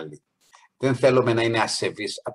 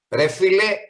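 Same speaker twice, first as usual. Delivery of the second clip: casual rude address; 9 semitones higher, 6 dB louder than usual. Retold or imitated. Quoting a rude street greeting.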